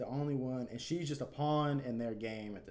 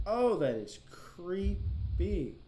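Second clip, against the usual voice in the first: creeped out; 8 semitones higher, 6 dB louder, much slower than usual. Acted. Reacting to Yuri's creepy scene.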